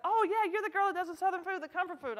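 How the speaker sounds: quick, high, laughing fan gush; imitating eager fans. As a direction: play the excited fan spotting her. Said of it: "'Cause they're like".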